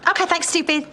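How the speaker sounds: brisk, offhand thanks with a sneer on stupid; snooty goodbye to the pilot; dismissive. Acted. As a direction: toss the insult off lightly.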